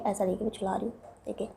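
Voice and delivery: ordinary talk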